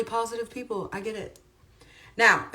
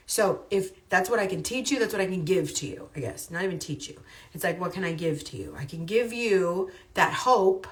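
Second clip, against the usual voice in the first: ordinary talk, nothing marked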